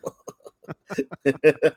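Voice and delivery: high, breathy burst of laughter; laughing. Real, not acted. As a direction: crack up for real. Laughs at the quarterback suggestion.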